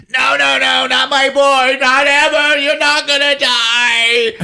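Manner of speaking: mock-melodramatic high cry; wailing mother. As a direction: imitate a desperate mother wailing. Acting mother of dying Charles.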